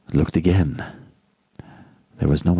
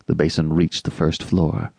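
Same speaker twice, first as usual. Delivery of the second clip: usual speaking voice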